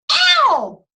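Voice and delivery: high, loud hammed-up yelp of pain; mock pain yelp. Patient yelping at the doctor with a high, loud mock cry of pain. Patient yelps, loudest punchline.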